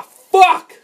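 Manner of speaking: very high, very loud curse; frustrated scream. Real, not acted. Gamer hit while fighting enemies, exasperated scream. Hit again during game combat.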